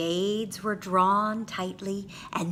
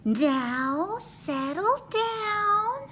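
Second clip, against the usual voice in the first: sweet, slow and much higher, coaxing; much slower than usual. A narrator's reading. Teacher sweetly coaxing her noisy class, sweet voice. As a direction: gently coax the class to settle. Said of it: "said Miss Nelson in a sweet voice".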